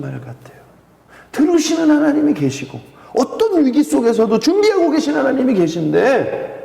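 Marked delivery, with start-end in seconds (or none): 1.3-6.6 louder, higher pulpit voice; preaching surge. Acted. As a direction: lift the declaration to the church.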